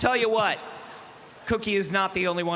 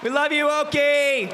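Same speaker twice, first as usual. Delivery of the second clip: raised, louder fan-like call; affectionate shout; 6 semitones higher, 5 dB louder than usual. Real, not acted. Host cheering the carver on, affectionate shout. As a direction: holler it across to the stage.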